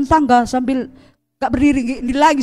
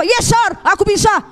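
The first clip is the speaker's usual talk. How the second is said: put-on high, eager young worker; eager yes sir; 8 semitones higher, slower than usual. Preacher replaying her younger self, high and eager. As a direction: answer the boss brightly and quickly.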